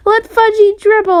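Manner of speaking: high, loud scream. A narrator's reading. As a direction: scream the accusation at mom. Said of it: "I screamed".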